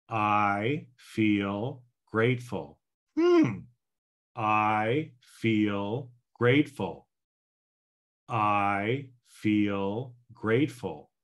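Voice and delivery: slow, deliberate I feel grateful; slow affirmation. Shown as a demonstration. Coach demonstrating the ten-by-three affirmation slowly. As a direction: say it slowly, calmly, with conviction. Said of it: "so here we go again".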